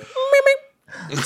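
squeaky kazoo-like meep; mock Subaru horn beeps; high horn imitation. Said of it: "the Subaru horn is real high pitch".

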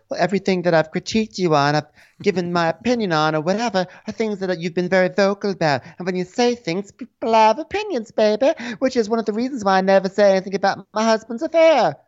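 high-pitched mock self-defence; Jennifer. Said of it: "And Jennifer goes".

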